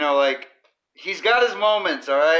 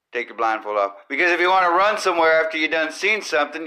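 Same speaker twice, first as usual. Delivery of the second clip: ordinary talk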